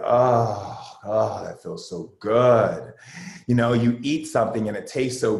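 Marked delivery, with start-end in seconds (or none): 0.0-2.9 pleasure moans. Retold or imitated. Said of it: "you just start letting yourself make those noises".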